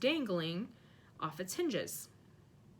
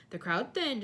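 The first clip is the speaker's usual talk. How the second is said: ordinary delivery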